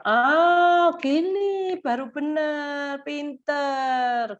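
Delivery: high, bright-eyed cheering of a small win; excited praise. Shown as a demonstration. Mother cheering her child for opening the mouth right, excited praise. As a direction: cheer the child on like they just nailed it.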